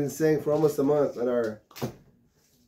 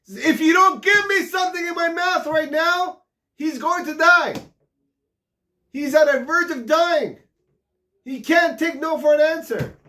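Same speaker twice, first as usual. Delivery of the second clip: much higher, loud shouted demand; desperate shout; 13 semitones higher, 6 dB louder than usual. Acted. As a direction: shout that someone will die. Destitute man's desperate demand.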